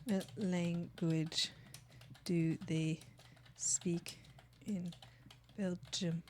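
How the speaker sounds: soft, slow murmur while searching; quiet typing murmur. Real, not acted. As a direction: read the search aloud under breath. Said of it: "I'm gonna look it up".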